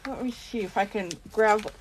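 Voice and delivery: talking around a pen in the mouth; words muffled by a pen held in the mouth; pen in teeth. Real, not acted. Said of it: "sorry, I put my pen in my mouth".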